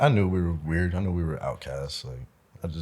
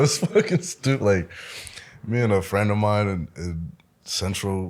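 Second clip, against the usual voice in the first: ordinary talk, nothing marked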